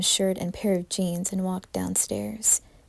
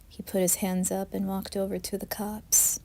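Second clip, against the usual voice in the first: ordinary delivery, nothing marked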